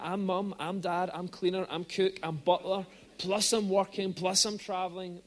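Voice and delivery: ordinary talk, nothing marked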